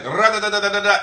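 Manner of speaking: high, loud rattled nonsense; mimicked gaslighter. Gaslighter telling others something different, loud mimicking babble. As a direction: parody someone's talk as rapid da-da syllables. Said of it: "walk out there and be like".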